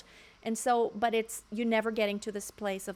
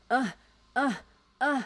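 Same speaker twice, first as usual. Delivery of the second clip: short effortful "uh" sounds, one at a time; pushed-out grunts; 4 dB louder than usual. Retold or imitated. Coach imitating a singer constantly pushing outward, strained grunts. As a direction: push each breath outward, imitating strain. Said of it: "she's constantly pushing outward".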